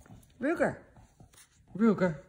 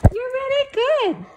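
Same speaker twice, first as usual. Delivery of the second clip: high pet praise. Real, not acted. Owner praising her good dog in high baby talk.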